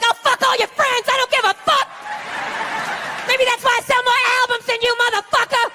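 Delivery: hammed-up yelling girlfriend; mock screaming girlfriend. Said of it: "screaming in his ear some crazy female shit".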